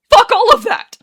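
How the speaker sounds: very high, very loud shout; explode, cutting him off; furious outburst. Acted. Arthur cuts Daniel off in quarrel.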